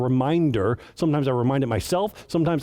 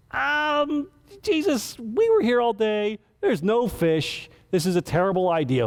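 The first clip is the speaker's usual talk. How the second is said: snarky; 7 semitones higher than usual. Retold or imitated. Simon answering Jesus with tired sarcastic snark. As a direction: play it like a tired fisherman doubting the boss. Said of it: "a little bit of snark".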